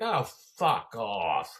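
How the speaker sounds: loud, slow, drawn-out dismissal; mock weary yell; fed-up heckler shout. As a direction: bellow it in imitated exasperation.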